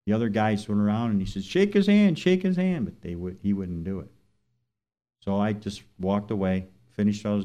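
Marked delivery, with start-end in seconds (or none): 1.4-2.8 high, loud urging shout. Retold or imitated. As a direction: call out to get him to shake hands. Bystanders urging a handshake.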